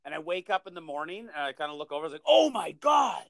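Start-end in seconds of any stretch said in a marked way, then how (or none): 2.3-3.2 retold shock exclamation. Waking to find the mess.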